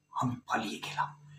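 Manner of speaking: a low, hushed whisper; ashamed whisper. Acted. Friend confessing to the dead friend that he fled, in an ashamed whisper.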